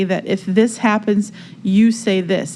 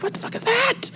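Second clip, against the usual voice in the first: much higher, laughing half-cry of shock; shocked outburst. Retold or imitated. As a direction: recoil at yourself in a high, laughing cry. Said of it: "I'm like".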